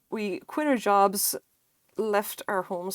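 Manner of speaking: normal talking voice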